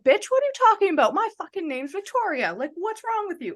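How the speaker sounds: hammed-up rude comeback, imitating what she didn't say; mock rude retort. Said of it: "like, a rude way".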